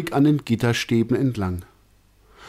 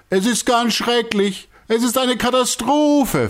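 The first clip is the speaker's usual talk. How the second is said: even higher despairing wail; distraught director; 14 semitones higher, 4 dB louder than usual. A narrator's reading. Director answering everyone who asks, high despairing wail. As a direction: cry it out, beside himself. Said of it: "von sich gibt".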